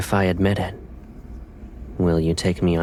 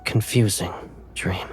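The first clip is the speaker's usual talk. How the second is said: sleepy whisper; whispered Caleb. Acted. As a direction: whisper like he just woke. Held whispered character voice.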